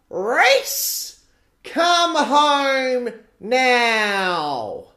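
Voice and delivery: high, loud, slow call across the park; mum yelling. Retold or imitated. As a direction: call your son home from far away. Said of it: "And she yells".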